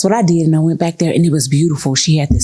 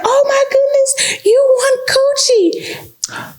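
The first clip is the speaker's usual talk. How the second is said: much higher, squealing gleeful; higher, mock-giddy prize reveal; excited announcer; slower than usual. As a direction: announce the prize like a gameshow host. Consultant announcing wheel prize.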